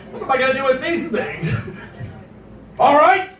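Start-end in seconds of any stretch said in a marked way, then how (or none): 2.8-3.3 high-pitched hijacker demand; hammed-up, forceful ultimatum; mock hijacker threat. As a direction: threaten the whole cabin over something tiny.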